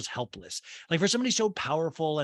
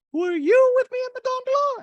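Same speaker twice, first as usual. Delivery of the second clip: put-on high, loud accented lady; Dorit impression; 7 dB louder than usual. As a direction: ask it like Dorit. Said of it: "And Dorit goes".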